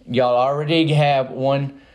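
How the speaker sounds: usual speaking voice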